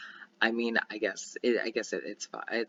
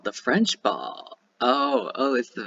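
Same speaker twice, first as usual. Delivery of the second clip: ordinary talk, nothing marked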